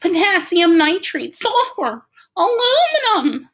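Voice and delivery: rising joy, ending in a laugh; excited shouting. A narrator's reading. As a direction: shout the list to the fireworks. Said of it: "keeps on shouting out the names of chemicals".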